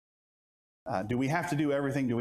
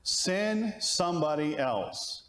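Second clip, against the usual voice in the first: slow plea; 4 semitones higher, much slower than usual. Acted. Moses' final plea voiced slowly.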